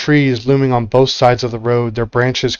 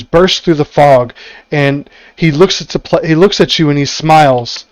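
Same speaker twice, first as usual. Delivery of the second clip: normal talking voice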